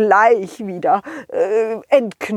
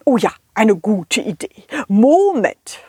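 plain reading voice

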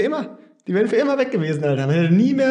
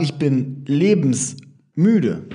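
"lebensmüde" drawn out slowly as one word, lower pitch; slow emphatic "lebensmüde" said as one word; slow, stressed lebensmüde; 3 semitones lower, much slower than usual. Shown as a demonstration. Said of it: "ich würde es einfach sagen".

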